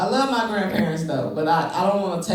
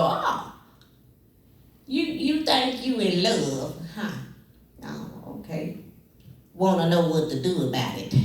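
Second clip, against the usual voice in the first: grandma voice; 3 dB quieter than usual. Retold or imitated. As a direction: tease like a knowing old grandma. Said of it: "and she go".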